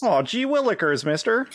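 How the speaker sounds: put-on high, eager golly-gee kid voice; wholesome 1950s kid. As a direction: play an old-timey eager kid. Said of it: "I'm trying to be family".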